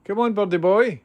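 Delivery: high, loud sing-song call; coaxing a wild bird. Real, not acted. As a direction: call the bird sweetly but loudly.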